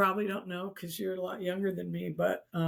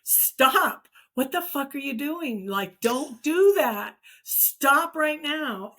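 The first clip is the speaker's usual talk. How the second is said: raised, higher voice, urgent commands; imitated yelling; 7 semitones higher, 6 dB louder, slower than usual. Crowd of voices on the phone warning her, imitated yelling. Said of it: "were s-, yelling".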